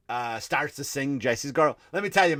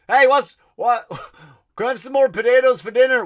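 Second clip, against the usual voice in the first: put-on high, loud, quick child begging for potatoes; hungry child's voice; famine child asking; 9 semitones higher, 11 dB louder than usual. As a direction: plead like a hungry kid.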